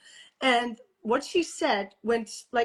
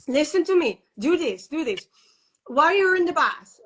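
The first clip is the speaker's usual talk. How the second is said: higher, louder mock bossy command; bossy order; 4 semitones higher, 5 dB louder than usual. Midwife ordering the labouring mother about, mock bossy. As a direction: imitate the pushy midwife barking instructions.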